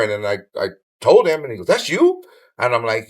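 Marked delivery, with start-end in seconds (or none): 1.7-2.2 retold astonishment, jumping high; astonished. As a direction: act out a surprised question, pitched way up. Author's astonished question, retold.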